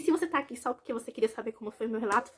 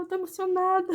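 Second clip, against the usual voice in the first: high, choked tearful reply; half crying, half laughing at herself; retelling her teary reply to her husband; tearful answer; 8 semitones higher than usual. Retold or imitated. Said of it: "quando eu comecei a chorar".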